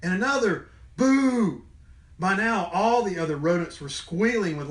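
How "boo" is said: loud, high boo; jump-scare the leaf; sudden boo. Acted. Capybara shouting boo at a leaf.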